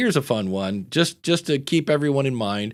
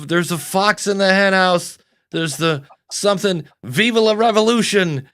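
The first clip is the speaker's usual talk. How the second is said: put-on drunken shout; 7 semitones higher, 5 dB louder than usual. Tipsy Todd hailing from step.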